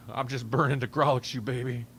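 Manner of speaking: ordinary talk, nothing marked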